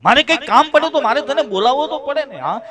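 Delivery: put-on insistent old father. Old father arguing he must call his son.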